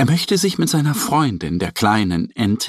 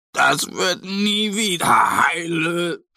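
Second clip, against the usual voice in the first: slow, higher, whiny lament; wailing; 6 semitones higher, slower than usual. A narrator's reading. Mo wails to Annie that the bag is ruined, slow whiny moan. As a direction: moan it like a hopeless little bear. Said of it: "jammert er".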